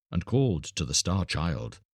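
usual speaking voice

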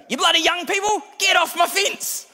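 put-on high, loud, furious old-lady yell; shrieking neighbour scolding from the door; angry shout. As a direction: bark it at the kids on the fence. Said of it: "very angry lady".

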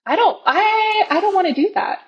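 a mock whiny I don't wanna; whiny protest. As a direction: protest like a reluctant child. Retold protest to God.

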